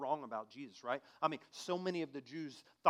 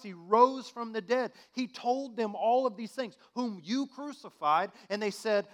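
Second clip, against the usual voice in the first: loud, high sermon shout; impassioned preacher proclaiming; fiery preaching; 8 semitones higher, 11 dB louder than usual. Acted. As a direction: preach it out at full force. Preaching at full force.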